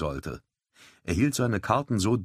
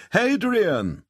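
high, loud happy hail across the room; glad call; 6 dB louder than usual. A narrator's reading. Wyatt greets Hadrian, calling his name gladly. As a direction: call out to a welcome friend. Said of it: "rief Wyatt erfreut".

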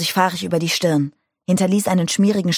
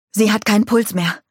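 a raised, slightly higher panicked cry; shouting; 4 semitones higher, 3 dB louder than usual. A narrator's reading. Nick crying out over the dead woman, shouting. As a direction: call it out in alarm over the body. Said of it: "schrie er".

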